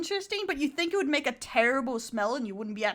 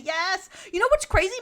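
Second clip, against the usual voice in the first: excited; 9 semitones higher, 7 dB louder than usual. Real, not acted. Hyped about pizza oven.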